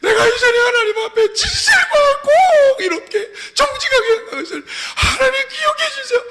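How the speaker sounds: very high, crying shout; acted sobbing prayer. Weeping king begs God to remember him, sobbing. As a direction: plead through sobs, voice far up high. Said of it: "그러면서 막 울면서".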